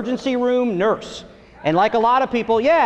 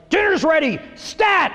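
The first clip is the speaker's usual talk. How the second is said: ER nurse barking orders; 7 semitones higher than usual. Retold or imitated. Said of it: "She'd be like".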